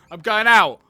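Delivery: excited shout. Acted. Child going out for the first time, excited shout. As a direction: burst out with the big news.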